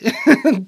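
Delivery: short, loud exasperated laugh; high laugh of disbelief. Real, not acted. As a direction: burst out in a brief incredulous laugh. Exasperated at vague "toxins.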